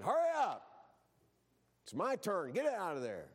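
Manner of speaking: high, loud mock shout at the bathroom door; a hammed-up family morning yell; impatient yell. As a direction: yell at them to hurry up.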